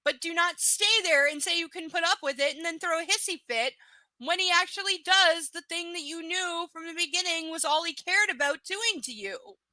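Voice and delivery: normal talking voice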